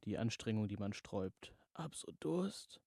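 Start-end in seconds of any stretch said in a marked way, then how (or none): 1.7-2.8 high, slow croak of thirst. Acted. Samantha croaks to the voice that she is thirsty. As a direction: croak it out, parched. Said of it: "krächzte Samantha".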